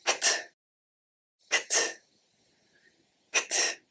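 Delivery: kt said as a soft k then t, repeated; kt sound. Shown as a demonstration. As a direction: clip "kt" quietly, k straight into t. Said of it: "it's pronounced".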